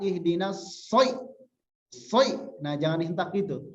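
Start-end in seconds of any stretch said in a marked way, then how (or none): 0.0-2.4 Soi jerked out high and abrupt; wrong jolted soi. Shown as a demonstration. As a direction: jolt the Soi as the mistake to avoid.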